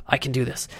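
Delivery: softly muttering to himself; quiet self pep talk. Retold or imitated. Cramping man on a bus, quietly pumping himself up. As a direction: psych yourself up under your breath. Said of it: "pump myself up".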